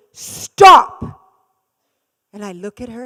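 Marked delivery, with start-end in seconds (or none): 0.1-1.2 one high, very loud barked command; nurse's sharp shout. Retold or imitated. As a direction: snap her out of it with one word. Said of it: "And she goes".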